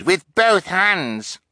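slow, high-pitched cry. A narrator's reading. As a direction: stretch out the eager boast. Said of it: "Kevin cried".

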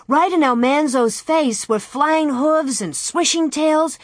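high, urgent storytelling; excited narration. A narrator's reading. Narrator describes hooves in Almanzo's face, excited. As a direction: tell it as the chaos unfolds.

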